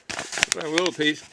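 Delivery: usual speaking voice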